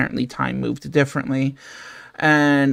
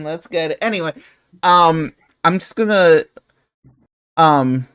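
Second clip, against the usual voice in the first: ordinary talk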